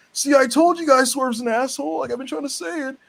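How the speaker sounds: high-pitched, indignant mock voice; squeaky mock I-told-you-so; whiny Mark Sterling. Imitating Sterling's outburst against Swerve.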